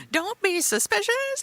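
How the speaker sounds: "Don't be suspicious." squeaked high, rising on "suspicious"; high mock warning. Shown as a demonstration. Friend joking about a shady money scheme, high squeaky voice. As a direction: squeak it up like a sneaky cartoon.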